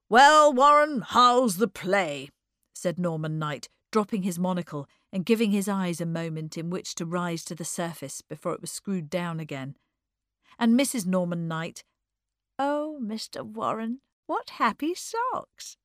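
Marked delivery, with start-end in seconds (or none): 0.1-2.3 loud, drawn-out greeting; hearty man. Acted. Norman Knight hailing the young playwright, loud and hearty. 12.6-15.8 slow coo leaping high; gushing society lady. Acted. Norman's wife admiring Eddie's socks, gushing.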